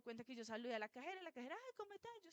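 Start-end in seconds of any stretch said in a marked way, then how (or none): 1.5-2.1 much higher, softer friendly greeting; high soft sweet cashier hello; mock cashier greeting. Cashier greets her warmly.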